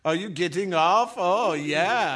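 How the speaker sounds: loud hammed-up pleasure moan; mock moaning. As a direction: overdo it like a bad porn actor. Mock moaning joke, laughing.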